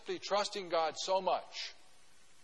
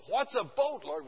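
higher, louder puzzled question; as if he has never heard the word boat; baffled Noah; 5 semitones higher, 4 dB louder than usual. Retold or imitated. Pastor voicing Noah puzzled at God's command, higher and louder. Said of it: "I can imagine Noah".

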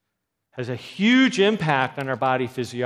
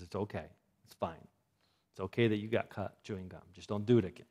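reassuring mother; 4 semitones lower, 7 dB quieter, faster than usual. Retold or imitated. Mother hugs and reassures daughter.

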